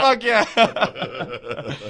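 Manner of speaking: loud laughing victory yell; laughing triumph. Retold or imitated. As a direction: cheer it while cracking up. Triumphant retold answer.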